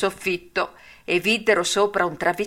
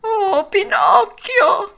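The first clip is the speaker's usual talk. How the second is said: high, loud, slow whimpering wail; the fox begging in a fake sob; whining fox voice; 6 dB louder, much slower than usual. A narrator's reading. Fox wailing pitifully to Pinocchio. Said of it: "con una voce di piagnisteo".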